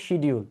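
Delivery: Bangladeshi শিডিউল said as "shi-di-ul"; sh start and clear d in শিডিউল; Bangladeshi pronunciation. Shown as a demonstration. Teacher showing the local form, শিডিউল as "shi-di-ul". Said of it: "বাংলাদেশিরা বলি আমরা হচ্ছে".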